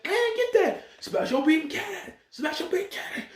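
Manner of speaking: high squeaky mock anime villain. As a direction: parody the attack call in a silly high voice, laughing. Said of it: "He's like".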